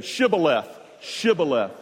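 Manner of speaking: Shibboleth with a clear sh, twice over. Shown as a demonstration. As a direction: repeat Shibboleth, crisp sh each time. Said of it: "Say it again".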